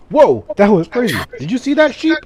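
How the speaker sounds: high, loud startled creature voice; loud, high-pitched amazed golem; excited fire golem. Acted. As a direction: blurt it out as an astonished fire golem, pitched right up. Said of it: "a fire golem from the Fey Realm is standing, who goes".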